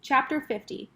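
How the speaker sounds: usual speaking voice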